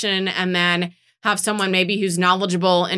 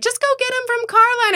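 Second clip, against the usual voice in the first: teasing imitation, much higher; mock eager friend; 13 semitones higher than usual. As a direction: parody your cohost's eager advice. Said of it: "And you were like".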